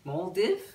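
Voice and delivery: "Moldive" as a quick "mol-dihv?", rising; questioning pronunciation. Real, not acted. Vlogger testing a shop name, questioning "mol-dihv?". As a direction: guess "Moldive" with a short i and questioning lift.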